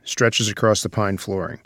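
normal talking voice

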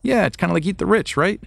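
light chuckling impression of someone catching on; imitating a casual passerby. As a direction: play a random person getting the joke. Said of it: "people are like".

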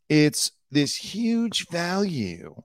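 high, stretched-out salesman mimicry; Dean. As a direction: dangle a big value before buyers. Mimics Dean's sales pitch.

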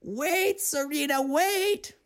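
high call after her. A narrator's reading. Godmother calling to Serena running to the water, high call. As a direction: call after the fleeing girl. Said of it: "her godmother called".

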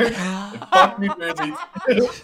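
high, slow laughing jab at New Jersey; cursing the state with a cackle; laughing curse. Acted. Mock-angry rant, laughing.

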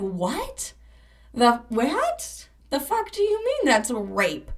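half-imitated stunned outburst; shocked disbelief. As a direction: mimic her younger self reeling. Reacting to friend's shocking word.

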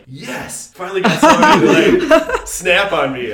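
loud, high, laughing retold triumph; pumped up. Host retelling his reply to a negative comment, pumped and laughing. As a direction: replay being thrilled, breaking into laughter. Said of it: "I was all pumped about it".

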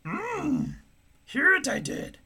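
hint of the little Jedi master; mild Yoda word order; Yoda impression. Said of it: "fun to talk like Yoda".

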